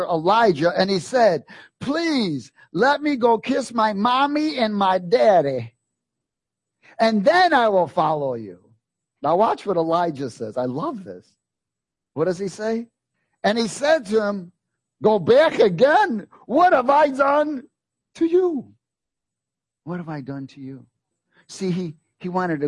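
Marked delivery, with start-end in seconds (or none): 1.8-8.6 put-on high, slow, childlike plea; pleading Elisha. 15.0-16.3 high, slow, loud rebuke; stern Elijah. Acted. Elijah answering Elisha. 16.5-18.7 high, slow, loud question; loud high take. Shown as a demonstration. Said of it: "contrasted with quiet repeat". 19.8-20.8 quiet repeat. Shown as a demonstration. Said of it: "repeated quietly after loud take".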